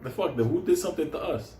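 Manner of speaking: asking his friend what happened to them; confused protest. Retold or imitated. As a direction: sputter in disbelief. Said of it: "I'm like".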